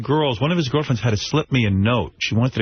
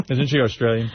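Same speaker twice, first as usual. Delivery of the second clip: ordinary talk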